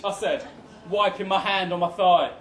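usual speaking voice

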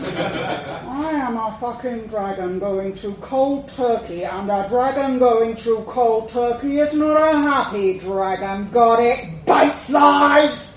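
building from controlled to a loud roar; escalating dragon outburst, punched loud at the end; angry dragon rant. A narrator's reading. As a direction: let the fury mount, ending in a shout.

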